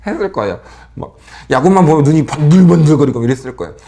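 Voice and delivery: ordinary delivery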